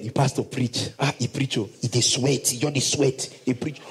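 dismissive Nigerian Pidgin answer; careless churchgoer. Retold or imitated. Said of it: "you shout".

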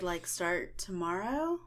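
ordinary delivery

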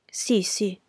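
plain reading voice

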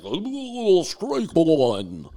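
loud, high, drawn-out umpire call; umpire Joe West. Retold or imitated. Mocking the umpire behind the plate, hammed-up strike call. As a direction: bellow the strike call in exaggerated mockery. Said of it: "Joe West back there".

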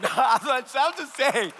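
repeated through a laugh, slowly. Real, not acted. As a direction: say it again chuckling, drawn out.

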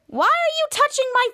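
high-pitched outraged question; acted indignant demand. Sister confronting boy over her phone, mock outrage. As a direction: demand it, pitch jumping right up.

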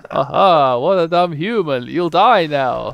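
loud, slow gloating villain. Acted. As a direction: sneer it like a cocky villain. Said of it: "says Asgar".

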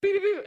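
high-pitched speed-button beeps; treadmill beeps. Retold or imitated. Storyteller mimicking her treadmill as she runs, high beeping. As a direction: imitate a machine beeping, very high. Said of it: "she keeps, like".

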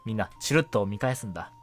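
ordinary talk

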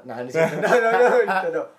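loud, high laughing protest; laughing retraction. Real, not acted. Host takes back a joke about violence, laughing loudly. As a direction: backpedal fast through laughter.

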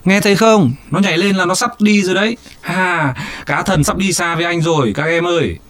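high, fast excited voice. A narrator's reading. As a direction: rattle it off in a rush. Boy excited over jumping fish.

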